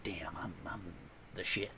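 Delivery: smug little whisper to yourself; hushed, pleased self-congratulation; whispered brag. Retold or imitated. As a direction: savor it in a private whisper. Smug self-praise quoted.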